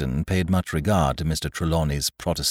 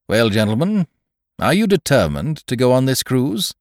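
higher and louder, pressing question; forceful captain; 9 semitones higher, 9 dB louder than usual. Acted. Captain challenging the gentlemen on the cruise, forceful. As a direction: put it to them squarely.

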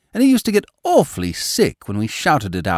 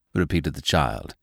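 usual speaking voice

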